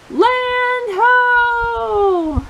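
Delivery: high, ringing call from the crow's nest; hammed-up sailor's cry. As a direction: shout it across the sea. Pete calls out from crow's nest.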